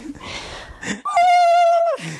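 loud, very high sustained squeal; random high noise. Real, not acted. Podcaster blurting a random noise mid-chat, piercing high squeal. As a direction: let out a long, silly high hoot. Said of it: "What's that supposed to be?".

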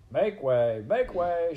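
loud, high crowd-clearing shout. A narrator's reading. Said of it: "shouted Furlow".

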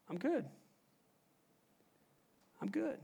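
sigh, then very soft acted relief; shaken self-reassurance. Tuber halfway down telling himself he's fine, softly. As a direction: let it out quietly after a breath.

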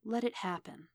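soft, hushed inner devil; hushed, insinuating whisper. A narrator's reading. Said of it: "The devil roiled and whispered".

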